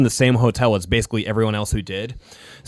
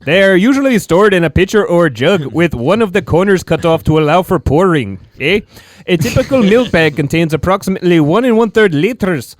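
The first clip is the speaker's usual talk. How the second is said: put-on Canadian accent; 5 semitones higher, 5 dB louder than usual. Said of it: "it's getting weirder and weirder".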